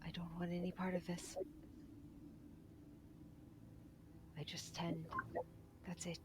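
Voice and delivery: small frightened whisper from under rubble; buried underground. Acted. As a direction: whisper it, scared and hidden. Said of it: "you hear a small voice".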